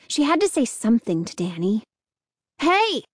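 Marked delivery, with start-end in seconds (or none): 2.6-3.0 short, high call. A narrator's reading. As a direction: call to catch someone's attention. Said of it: "she called".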